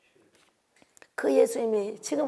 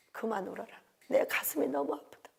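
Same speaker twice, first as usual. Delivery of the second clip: tender father; 10 dB quieter than usual. Retold or imitated. God's voice comforting in dream.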